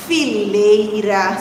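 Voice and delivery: "fileira" low and slow, long middle syllable; drawn out. Shown as a demonstration. Teacher compares rhyming words for children, drawn out. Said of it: "Se eu parar bem pra pensar".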